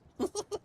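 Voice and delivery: high squealing giggle; ticklish giggle. Real, not acted. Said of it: "it's tickling me".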